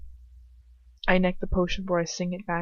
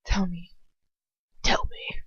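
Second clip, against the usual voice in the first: mock pleading whisper. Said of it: "Just kidding".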